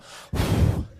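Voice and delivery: loud puff of breath, no voice; imitated exhale. Imitating the customer's impatient sigh at the barista, brisk huff. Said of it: "punch me in the face and go".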